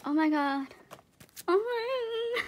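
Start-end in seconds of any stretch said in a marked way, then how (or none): 1.5-2.4 high, tearful disbelief; speechless, near tears. Real, not acted. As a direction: trail into a high, choked-up cry. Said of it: "I'm speechless right now".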